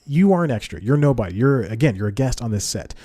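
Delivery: ordinary talk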